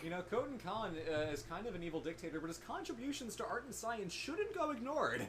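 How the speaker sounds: mock-earnest defence, climbing high; sarcastic. Player defending the evil dictator to wind up a friend, sarcastic. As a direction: argue it drily, pitch rising at the end. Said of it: "I know you're being sarcastic".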